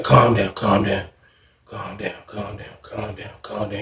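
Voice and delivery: very low, hushed calm-down; creepy soothing. Retold or imitated. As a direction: fade into a soft, low lull while massaging shoulders. Acts Pop massaging, soothing him.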